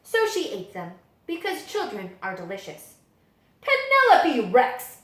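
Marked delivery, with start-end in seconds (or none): 3.6-5.0 high, loud, slowed stern call of the name; stern teacher call. A narrator's reading. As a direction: call her out like a shocked teacher. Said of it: "said Missus Noodleman".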